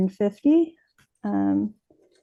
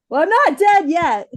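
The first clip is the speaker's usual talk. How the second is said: much higher, louder acted delight; happy exclamation; 9 semitones higher, 7 dB louder than usual. Dad reassures crying daughter, acted happy outburst. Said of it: "he got really happy".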